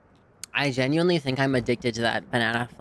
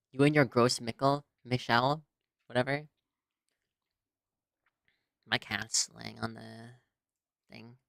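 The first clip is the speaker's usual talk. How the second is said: ordinary talk, nothing marked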